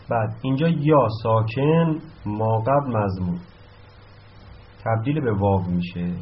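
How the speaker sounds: ordinary talk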